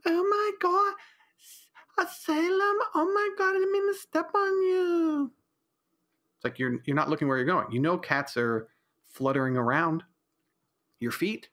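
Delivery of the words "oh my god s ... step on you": very high, wailing mock-distress; mock panicked wife. Host imitates his wife apologizing to the cat, high and wailing. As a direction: overact her panic over stepping on the cat. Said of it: "and she goes".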